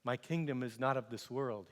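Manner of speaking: low, soft and unhurried; calm, quiet reply. Acted. Accused prisoner answering the governor, calm and quiet. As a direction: answer quietly, without any fight.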